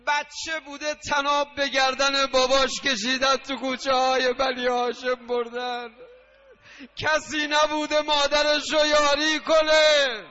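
high, loud lament at full force; impassioned mourning-sermon shout; wailing preaching. Real, not acted. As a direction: cry out the tragedy to mourners, drawing out the endings. Preacher cries out a tragic lament.

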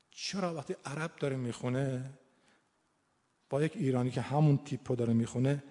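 ordinary talk

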